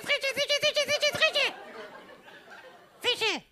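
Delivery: high, stumbling tongue twister; tongue twister attempt. Shown as a demonstration. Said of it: "musst du sagen". (Zungenbrecher, stolpernd und hoch)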